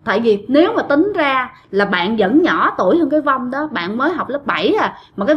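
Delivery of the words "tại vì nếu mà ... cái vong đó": usual speaking voice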